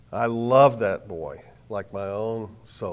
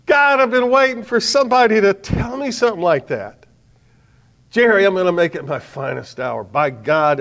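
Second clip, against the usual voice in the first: high and loud, choked with tears; young man crying out at the dining table, high and loud; tearful outburst; 8 semitones higher, 9 dB louder than usual. Retold or imitated. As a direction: break open with relief. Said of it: "began to cry".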